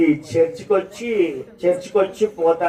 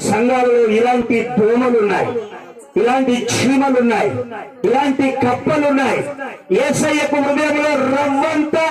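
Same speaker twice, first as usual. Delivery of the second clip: sermon cry climbing higher phrase by phrase; forceful preaching, building; 10 semitones higher than usual. Real, not acted. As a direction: build the denunciation, pitch rising.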